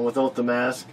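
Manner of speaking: usual speaking voice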